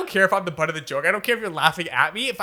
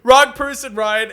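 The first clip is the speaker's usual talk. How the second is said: louder scolding; 5 semitones higher, 5 dB louder, slower than usual. Retold or imitated. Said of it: "she's like".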